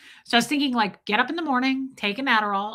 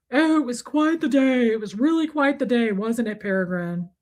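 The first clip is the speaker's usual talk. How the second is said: put-on character voice; 3 semitones higher than usual. Chatting to Peregrine about the day, affected character voice. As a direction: put on a voice and reminisce grandly.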